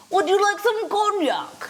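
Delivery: high, lilting mock English accent; put-on English accent. Shown as a demonstration. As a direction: offer it in a hammed-up English accent. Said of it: "You have to do the accent".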